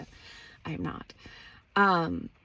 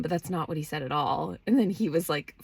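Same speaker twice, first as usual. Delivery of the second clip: usual speaking voice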